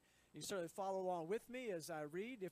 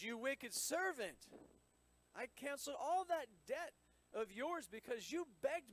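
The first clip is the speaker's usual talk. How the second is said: stern master; 7 semitones higher than usual. Acted. Master confronting the unforgiving servant, stern rebuke. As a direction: scold him as a wronged king.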